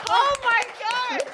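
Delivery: loud, high, amazed; excited shout. Real, not acted. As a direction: shout it in delight. Reacting to great performance.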